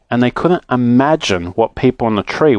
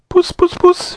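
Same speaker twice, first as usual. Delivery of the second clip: very high falsetto cat call. Acted. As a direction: call puss in a tiny high voice. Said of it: "he called".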